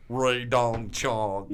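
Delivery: very slow, drawn-out manager impression; Barry Katz. Manager made to say one name, slow mimicked drone. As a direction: stretch every syllable way out. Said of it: "And he just goes".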